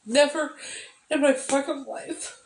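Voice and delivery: high, tearful voice near breaking; real crying through the words; choked-up, teary. Real, not acted. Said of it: "I don't know why I'm crying".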